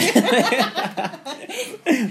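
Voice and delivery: high, loud laughing burst; a sudden laugh she can't hold back; burst of laughter. Real, not acted. Laughs at the [name] remark. (carcajada aguda)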